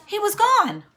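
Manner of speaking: ordinary delivery, nothing marked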